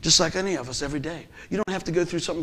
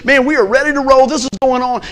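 excited; 8 semitones higher, 10 dB louder than usual. Retold or imitated. Thinking before the injury.

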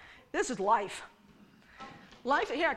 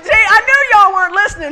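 loud teasing; 7 semitones higher, 14 dB louder than usual. Real, not acted.

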